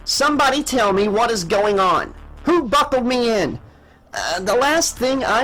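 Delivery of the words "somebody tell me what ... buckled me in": higher-pitched demand for answers; agitated demand. A narrator's reading. Captain turning to her crew for answers, agitated high demand. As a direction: press them urgently, pitched up.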